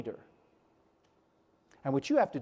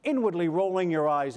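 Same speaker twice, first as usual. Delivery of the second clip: ordinary talk, nothing marked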